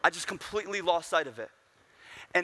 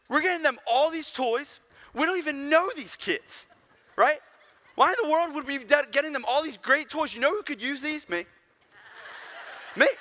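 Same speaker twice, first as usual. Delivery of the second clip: put-on much higher, louder kid protest; indignant six-year-old; 9 semitones higher, 3 dB louder than usual. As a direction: demand it like a spoiled little boy. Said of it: "Imagine six-year-old [name]".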